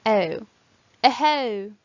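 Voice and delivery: "o" as E gliding into oo, then "eho" with it; EU diphthong. Shown as a demonstration. Teacher modelling a diphthong, "eho" with eh-oo glide. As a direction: glide eh-oo as one sound in "eho". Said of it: "are pronounced as one sound".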